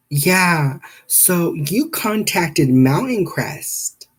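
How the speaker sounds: high, slow receptionist impression. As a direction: stretch it out like a call handler. Receptionist voiced in retold call.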